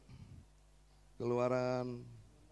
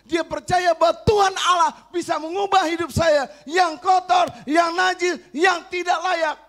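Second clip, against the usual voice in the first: much higher, much louder preaching shout; full-force preaching; 13 dB louder than usual. Acted. Preacher praising his wife's faith to the congregation, shouting at full force. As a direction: cry it out to the whole church.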